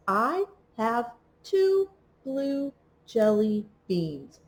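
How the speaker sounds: slow and even, one word per tap; slow word-by-word reading. Shown as a demonstration. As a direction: read it slowly, tapping each word for a child. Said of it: "read the sentence again".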